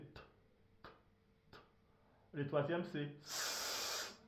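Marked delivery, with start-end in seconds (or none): 0.0-1.6 "T" as a bare whispered stop; isolated T. Shown as a demonstration. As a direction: tap out just the sound of "T". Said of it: "Le deuxième, c'est T".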